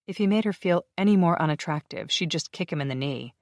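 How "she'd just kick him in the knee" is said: usual speaking voice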